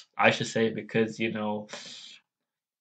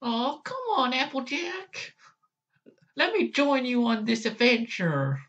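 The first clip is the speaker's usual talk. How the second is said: much higher little filly voice; Apple Bloom impression; 13 semitones higher than usual. Apple Bloom begging Applejack to join, high and eager. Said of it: "Apple Bloom's one's like this".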